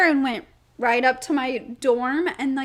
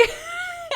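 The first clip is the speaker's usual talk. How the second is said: short high burst of laughter; a quick high giggle; 9 dB quieter than usual. Real, not acted. Laughing at the story.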